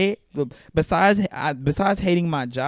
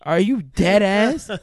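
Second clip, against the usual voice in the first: slow disbelief; 4 semitones higher, slower than usual. Retold or imitated. Retelling his reaction to the delay.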